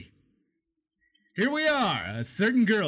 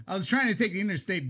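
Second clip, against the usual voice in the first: usual speaking voice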